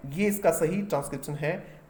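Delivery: ordinary talk, nothing marked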